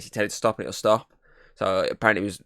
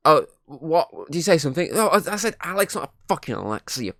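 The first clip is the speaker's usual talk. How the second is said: mock exasperated exchange; 7 semitones higher than usual. Acted row with the device.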